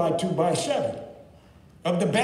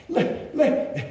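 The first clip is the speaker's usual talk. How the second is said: mocking crowd; 10 semitones higher than usual. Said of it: "And they said".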